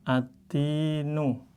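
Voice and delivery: atinu recited slowly and softly; Quranic recitation. Shown as a demonstration. Teacher repeating the reading for students, slow recited atinu. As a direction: stretch atinu out, skipping the lam. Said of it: "Jadi langsung".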